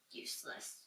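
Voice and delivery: dry, whispered verdict; a whispered one-word put-down; whispered aside. Acted. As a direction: breathe it like a deadpan aside.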